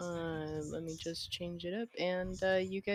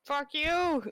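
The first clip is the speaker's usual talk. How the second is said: loud, high jokey insult; mock-angry high shout; playful shout; 10 semitones higher, 6 dB louder than usual. Real, not acted. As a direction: yell it at a friend in fun. Joking insults between friends.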